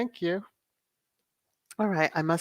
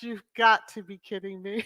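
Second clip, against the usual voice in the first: usual speaking voice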